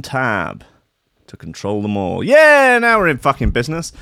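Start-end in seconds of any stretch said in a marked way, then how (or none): 2.2-3.9 loud high whoop, then fast; triumphant. Real, not acted. Host finally gets the news page working, loud triumph. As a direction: burst out in victory.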